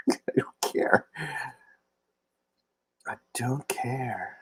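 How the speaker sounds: breathy whispered aside, very soft. Real, not acted. Joking to Thomas about cheap hotels, whispered. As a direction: whisper it like a secret.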